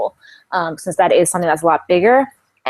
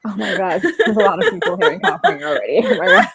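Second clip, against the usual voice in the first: laughing. Real, not acted. Laughing reply to moving joke.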